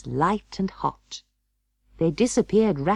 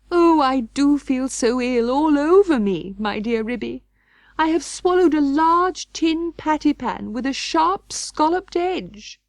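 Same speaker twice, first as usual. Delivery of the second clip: ill wailing Duchess; 10 semitones higher, 4 dB louder than usual. Acted. Duchess telling Ribby she feels ill, wailing high. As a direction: moan it like you're dying.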